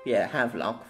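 ordinary delivery

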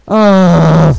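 long, loud cry of dismay; loud groan. Real, not acted. Player losing the fight, loud drawn-out groan. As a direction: groan it out at full volume. Dying in game.

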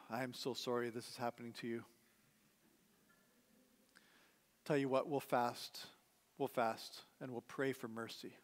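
a hint of grieving comfort; mock condolence. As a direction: console them as if at a funeral. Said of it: "What do you say?".

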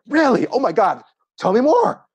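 high, loud, gushing enthusiasm; excited. Acted. Partner matching her excitement at job news, excited. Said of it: "when they're excited, you are excited with them".

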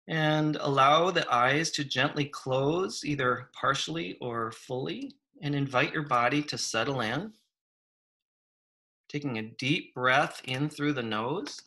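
unhurried meditation guide with long pauses; guided meditation. Real, not acted. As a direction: invite them to settle, phrases lifting at the ends.

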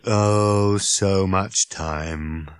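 slow, dreary robot groan; worn-out robot's lament. Acted. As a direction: drone it out, weary of existence. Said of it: "it groaned".

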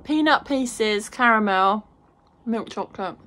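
talking through food, hand over mouth; muffled chewing speech; mouth full, chewing. Real, not acted. Seen 0: Bites bar just before; chews behind her hand while speaking.

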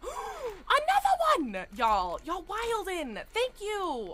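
loud, high, drawn-out cheer; real excited yelling, pitched high; overjoyed shout. Real, not acted. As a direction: whoop with thrilled gratitude. Celebrates another gifted subscription.

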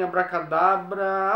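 stretched-out abracadabra, slow and deliberate; slow magic word. Acted. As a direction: draw out each syllable of the spell. Magic word tried twice.

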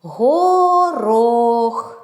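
Горох drawn out, both О sounds held; виділений звук О. Shown as a demonstration. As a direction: stretch Горох in two long syllables. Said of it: "В цьому слові теж два звуки О". («Горох» протяжно, з довгими «о»)

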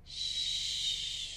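soft unvoiced shh; shushing. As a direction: hush the children gently. Hushing the kids.

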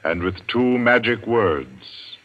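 ominous radio host build-up; dramatic host introduction; announcer host. Acted. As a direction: lead listeners into suspense. Host introduces tonight's play.

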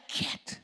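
short voiceless burst; sharp, soft shoo noise; shooing a bulldog. Acted. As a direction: spit out a dog-scaring sound. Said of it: "If a bulldog come after you".

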